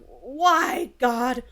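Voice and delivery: high, drawn-out cry to the heavens; hammed-up lament to God; mock anguished plea. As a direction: plead upward, theatrically despairing. Priest's drunken plea to God, acted.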